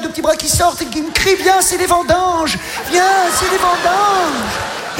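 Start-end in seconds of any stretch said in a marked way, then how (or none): 1.4-2.6 mock call. Hair tuft calling him. 2.9-5.0 slow echo. Acted. Slowed repeat of the call.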